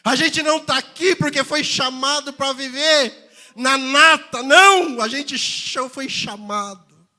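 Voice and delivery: high, loud preaching burst. Real, not acted. Pastor telling the church why they were called, fervent preaching. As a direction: surge up to a loud "não", then ease off.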